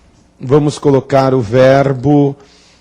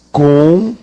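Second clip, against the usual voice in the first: syllable by syllable. Shown as a demonstration. Teacher showing the class the verb, syllable by syllable. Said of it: "Vejam isto".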